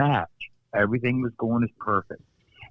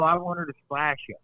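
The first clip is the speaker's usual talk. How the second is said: normal talking voice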